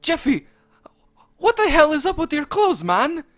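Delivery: high, loud cartoon chef voice calling out; Chef Pee Pee calling out. Chef calls out to Jeffy over Mario's shoulder, high and loud. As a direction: call across the kitchen in a high squeaky voice. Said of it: "Chef Pee Pee called out".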